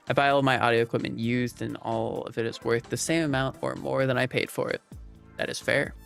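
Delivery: ordinary talk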